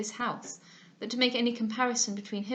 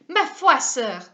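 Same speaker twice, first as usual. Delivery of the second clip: loud, indignant French exclamation; angry Madame Duval; 3 semitones higher, 11 dB louder, much slower than usual. Acted. Madame Duval to Mr Smith, loud and indignant. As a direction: snap it out in a huff. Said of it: "answered she angrily".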